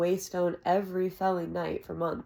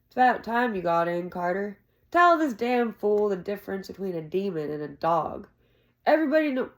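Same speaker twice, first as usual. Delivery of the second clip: louder, exasperated call across the room; irritated hail; 3 semitones higher, 7 dB louder than usual. Acted. Jake calling to Carter at the door, louder and exasperated. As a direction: drag Carter into the fight.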